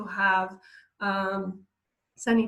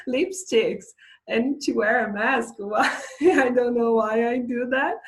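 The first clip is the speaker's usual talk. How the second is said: louder, laughing through the words; laughing confession; 3 semitones higher, 6 dB louder than usual. Real, not acted. Teacher admitting she wears lipstick under a mask, laughing. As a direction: talk through a real laugh at yourself. Laughing at her own habit.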